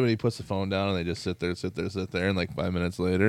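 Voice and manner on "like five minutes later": ordinary talk, nothing marked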